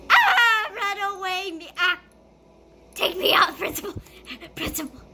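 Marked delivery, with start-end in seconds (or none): 0.1-0.7 scared scream. Real, not acted. Zombie appears.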